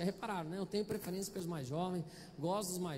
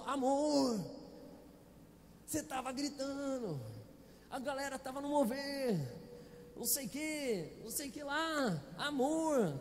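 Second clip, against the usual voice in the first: a hint of the wife gently scolding her husband; mildly imitating a wife's reproach; wife's voice, lightly voiced; 3 semitones higher, slower than usual. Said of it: "A consciência falou".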